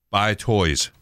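talking calculator voice. Retold or imitated. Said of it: "and it says".

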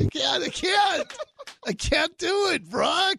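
high-pitched wailing plea; squirming, high-voiced protest; can't-take-it protest. Real, not acted. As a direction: cry out as if you can't bear another second. Reacting to mushy love clip.

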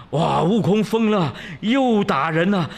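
loud, high cry of alarm; Bajie stirring trouble. A narrator's reading. Bajie reports the killing to Master, loud alarmed cry. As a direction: shout it out as a scandalized tattletale. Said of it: "八戒又火上浇油".

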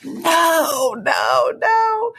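rising protest, the last no shouted high; anguished refusal. Retold or imitated. To God, refusing to give away her last money, crying no. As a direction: push back hard, each no climbing.

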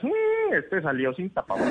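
high nosy hum then muttered gossip; nosy neighbour. Retold or imitated. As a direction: snoop from the window. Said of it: "todo mundo ahí en las ventanas". (vecina chismosa en la ventana)

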